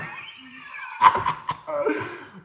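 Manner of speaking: high breathy laugh; real high, airy burst of laughter; overwhelmed laugh. Real, not acted. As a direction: laugh off the rising tears. Laughing off sudden emotion.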